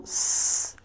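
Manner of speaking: S said as a long hiss, sss; letter sound. Shown as a demonstration. Teacher shows pupil the end of the name, sounding S as a hiss. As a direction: hold S as a pure consonant, no vowel. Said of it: "t'as le son S".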